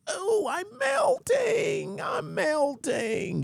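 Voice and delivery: high shrieking witch wail, then slow and fading; melting witch. Retold or imitated. Mocking his critics as a melting witch, shrieking. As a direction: ham up a dying witch's cry, stretched out slowly.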